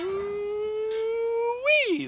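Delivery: a high, loud falsetto whoop of delight; excited squeal. Real, not acted. Grill cook squealing over his burgers, high and loud. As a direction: squeal it out like the food just blew you away.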